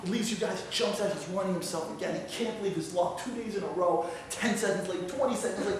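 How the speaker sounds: ordinary talk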